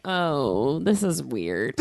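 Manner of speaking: low, muted mock realisation; dawning realisation. Woman retelling catching herself mid-habit, low dawning awkwardness. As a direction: let it sink in, dropping the pitch. Acts out sudden self-aware realisation.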